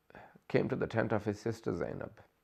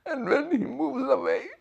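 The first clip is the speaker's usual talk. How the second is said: very high, slow tearful wail; words squeezed out through weeping; crying. Real, not acted. Preacher telling of baby Zainab parted from Hussein, crying. Preacher weeps during the story.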